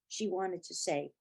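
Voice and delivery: ordinary delivery, nothing marked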